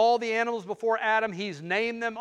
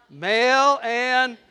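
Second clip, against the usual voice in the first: slow, higher call for response; drawn out again; 5 semitones higher, 7 dB louder, much slower than usual. Shown as a demonstration. Preacher prompting the congregation again, slow call. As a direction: leave it hanging for them. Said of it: "say that again".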